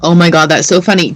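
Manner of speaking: ordinary talk, nothing marked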